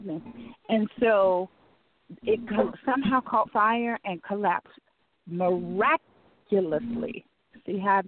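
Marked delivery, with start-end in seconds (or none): 5.3-7.3 drawn out, careful pronunciation; very slow. Real, not acted. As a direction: take the word very slowly, stretching it out. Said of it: "I had to say that very slow".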